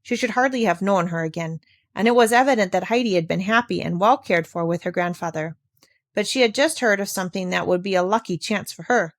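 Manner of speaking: usual speaking voice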